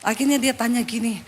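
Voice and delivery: usual speaking voice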